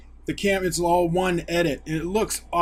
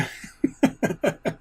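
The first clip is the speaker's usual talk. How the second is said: laugh; 7 semitones higher than usual. Real, not acted. Laughing at Davos's joke.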